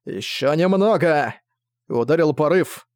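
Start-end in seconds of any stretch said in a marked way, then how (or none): 0.1-1.4 holding on. Acted. Commander holds for the moment.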